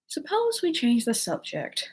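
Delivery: slow, drawn-out yawny suggestion; yawning. A narrator's reading. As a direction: interrupt with a lightly acted yawn.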